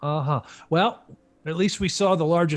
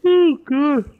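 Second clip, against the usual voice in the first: yawning through the words; words stretched by a big yawn; 12 semitones higher, 9 dB louder than usual. Real, not acted. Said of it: "There's my first yawn".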